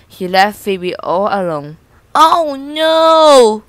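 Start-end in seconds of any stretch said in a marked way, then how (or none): 2.1-3.6 moaning. A narrator's reading. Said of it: "Phoebe moaned".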